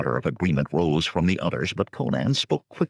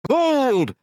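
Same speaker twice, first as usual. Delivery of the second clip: high, loud bellow; bellowing crowd; 6 dB louder than usual. Acted. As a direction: roar it with the whole band. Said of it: "they bellowed".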